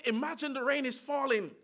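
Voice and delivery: normal talking voice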